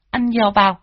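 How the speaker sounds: high, sugary coaxing; cooing to the dog. A narrator's reading. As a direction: coo at the pet dog. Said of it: "con Tâm cười híp mắt". (giọng nựng chó ngọt xớt)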